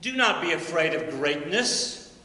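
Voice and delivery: slow, deliberate recitation, each phrase weighted; solemn recitation. Acted. Teacher quotes Twelfth Night to the honor society, slow and solemn. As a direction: let each line land slowly. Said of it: "he says".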